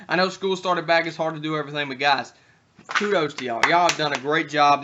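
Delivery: ordinary talk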